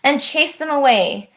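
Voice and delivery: lively rhyming read-aloud; bouncy storyteller voice for a children's book; story narration. A narrator's reading. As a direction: read it like a bedtime rhyme to kids. Reads rhyming picture book aloud.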